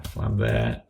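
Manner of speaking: a low, soft mutter of flam there while typing; muttered to self. Real, not acted. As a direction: drop the voice and mutter it to yourself. Counting flams while entering notes.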